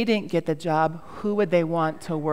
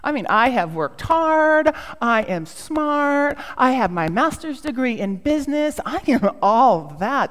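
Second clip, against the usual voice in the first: self-important boasting; 6 semitones higher, 4 dB louder than usual. Retold or imitated. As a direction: list your credentials with hammed-up pride. Retelling her boastful inner reply.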